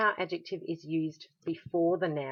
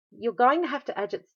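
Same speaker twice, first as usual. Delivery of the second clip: ordinary talk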